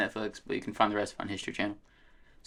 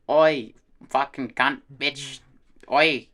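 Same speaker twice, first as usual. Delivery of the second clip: slow, higher mock Aussie accent; Australian accent; 4 semitones higher, 5 dB louder, much slower than usual. Shown as a demonstration. Friend demonstrates how the accent came out, exaggerated Australian accent. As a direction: drawl it out as a caricature Australian. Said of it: "the Australian accent was just like".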